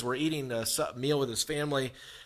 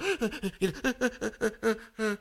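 a high, breathy nervous giggle; nervous high laugh; 7 semitones higher than usual. Retold or imitated. Dazed patient under smelling salts, high nervous laugh. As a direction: laugh it off while dizzy. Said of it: "I'm kinda going".

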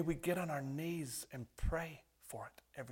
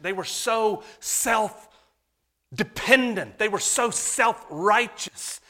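loud, slow, rising fervour; preaching at full force; 7 semitones higher, 15 dB louder, slower than usual. Acted. Preacher pleading with the church at full force. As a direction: hammer each line to the rafters.

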